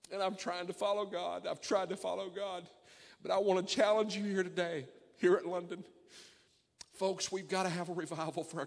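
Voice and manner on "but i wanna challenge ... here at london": ordinary talk, nothing marked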